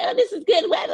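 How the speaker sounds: high, squealed mock delight; mimicking delighted locals. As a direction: put on someone else's gushing, loud little voice. Mimicking people happy in the cold.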